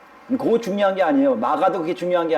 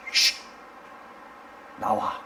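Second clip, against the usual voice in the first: a shush, then a low-key order; hushed command; 9 dB quieter, much slower than usual. Acted. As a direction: dismiss it softly with one word. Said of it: "오직 하나님의 그 말씀". (조용히 쉿 하고 나지막이 명령)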